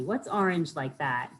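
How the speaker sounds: ordinary delivery, nothing marked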